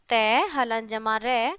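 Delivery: "तय हलन जमा रे" said slowly, each part apart; teacher slowly spells out the parts for the children; slow spelling out. Shown as a demonstration. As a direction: sound out the parts of the conjunct slowly. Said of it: "तो क्या बन जाएगा बच्चों?".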